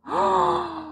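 sharp excited gasp. Real, not acted. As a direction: gasp at the sight of food. Sees the dish.

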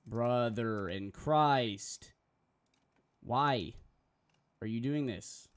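loud, very slow drawn-out plea; exasperated plea. Real, not acted. Streamer pleading with Photoshop to stop, loud and very slow. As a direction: stretch every word out in disbelief.

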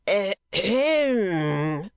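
long, swooping, bellowed throat-clearing; bellowed ahem. A narrator's reading. Neighbour behind the garden wall, bellowing ahem. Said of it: "in a kind of bellow".

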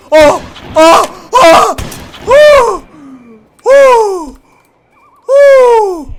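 very high, loud yelps; pained screams. Real, not acted. Gamer screams as the enemy hits him, high yelps. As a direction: yelp sharply in pain and shock. Screams while being hit.

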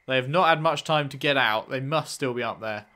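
ordinary talk

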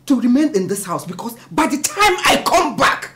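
loud threat, rising in pitch; heated and forceful; angry warning. Acted. Acted quarrel, warning before leaving.

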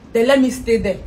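usual speaking voice

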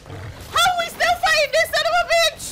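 high, loud disbelieving yell; mock-furious cry of disbelief; exasperated outburst. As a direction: shout it at the screen in disbelief. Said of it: "I was like".

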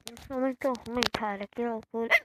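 loud, exasperated outburst; frustrated shout. Real, not acted. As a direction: yell at own bad play. Loses again in game.

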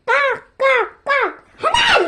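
slow high strained caws; crow caw. Acted. Mock crow count.

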